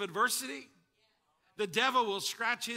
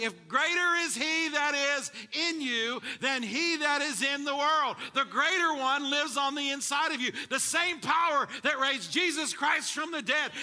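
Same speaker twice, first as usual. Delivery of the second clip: high, loud rising preacher voice; preaching at full force; 5 semitones higher, 5 dB louder than usual. Acted. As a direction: build it up to the climax. Preaching build to climax.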